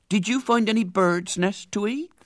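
high, eager odd voice; eager madman asking; interested lunatic voice. Acted. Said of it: "said the red-headed man with interest".

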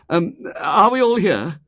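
high, fussy, flustered voice; harassed, anxious man. A narrator's reading. Tall man collecting his flock, harassed. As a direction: count heads anxiously in a high voice. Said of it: "He wore a harassed and anxious expression".

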